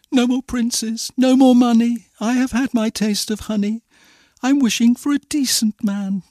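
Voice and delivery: high-pitched earnest heroine; high, heartfelt storybook girl; Cinderella. A narrator's reading. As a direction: plead in a high girlish voice. Cindy answers the fairy.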